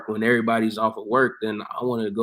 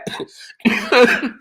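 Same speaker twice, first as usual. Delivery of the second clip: loud high laugh; 8 dB louder than usual. Real, not acted. Laughing at mom's anger.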